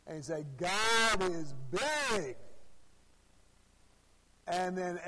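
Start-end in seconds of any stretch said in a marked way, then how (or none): 0.6-2.4 louder, slow, swooping proclamation; imitated preacher, more excited. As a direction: mimic a preacher getting worked up, stretching the words. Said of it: "he'd get a little bit more excited".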